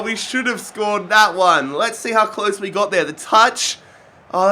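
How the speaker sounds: usual speaking voice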